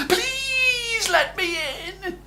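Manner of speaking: high, slow, crying plea; pleading Jack. A narrator's reading. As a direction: beg at the door like a tearful boy. Jack begging at the door.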